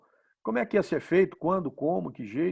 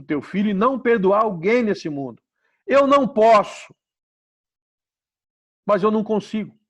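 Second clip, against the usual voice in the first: louder, higher pleading prayer; desperate prayer; 5 semitones higher, 8 dB louder, slower than usual. Acted. Man begging God for help to forgive, loud pleading. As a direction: cry out to God, at your limit.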